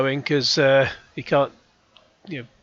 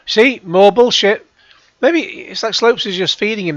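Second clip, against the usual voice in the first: scoffing shout; 7 semitones higher, 7 dB louder than usual. Real, not acted. Heckling the interview.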